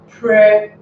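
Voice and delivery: "present" with a loud "pre", then a gap; stress on first syllable. Shown as a demonstration. Teacher showing which syllable is first, "present" with loud first half. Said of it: "must be stressed at the first".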